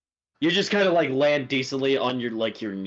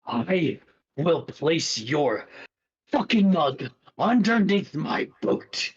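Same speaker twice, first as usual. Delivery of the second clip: snarling threat; 3 semitones higher, slower than usual. Acted. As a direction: drag it out, then bite the end. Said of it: "He's like".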